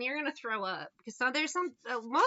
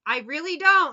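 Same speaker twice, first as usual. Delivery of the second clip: flat refusal; 5 semitones higher, 11 dB louder than usual. Retold or imitated. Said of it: "And I'm like".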